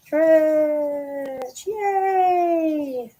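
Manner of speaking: long squeaky held notes; high cheering. Real, not acted. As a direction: draw each word out very high for a pet. Cheering the cat's stretch.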